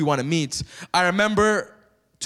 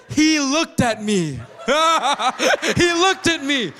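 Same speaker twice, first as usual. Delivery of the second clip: laughing; 6 semitones higher, slower than usual. Real, not acted. Giddy retelling of celebrity glance.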